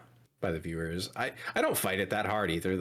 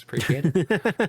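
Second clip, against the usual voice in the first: sarcastic thanks; 7 semitones higher, 8 dB louder than usual. Real, not acted. Mock thanks after clip request.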